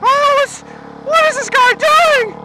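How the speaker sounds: high, slow, loud flustered whine; timid flustered person. Retold or imitated. Mocking timid people who fear the president, high slow whine. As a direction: fret like a frightened old worrier. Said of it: "They're like".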